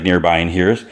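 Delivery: ordinary delivery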